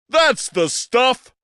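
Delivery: high, slow, hearty booming cheer; rally them with a grand old-showman shout; a hammy stage rallying cry. Acted. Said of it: "Bostwick cried".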